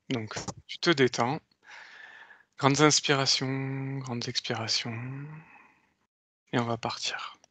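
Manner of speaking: slow, soft hypnotic countdown; slow hypnotist voice. Acted. Leads a hypnotic induction. (voix lente d'hypnotiseur)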